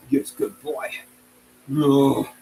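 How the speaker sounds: ordinary talk, nothing marked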